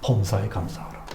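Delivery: quiet recalled sermon line; soft quote of 범사에 감사하라; quoting the pastor. Retold or imitated. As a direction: say it low, as remembered. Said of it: "목사님 뭐라고 설교".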